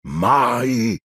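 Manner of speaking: a booming giant's single word; thundering. A narrator's reading. Giant defending the headmaster, thundering. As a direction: boom it out like thunder. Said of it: "tuonò".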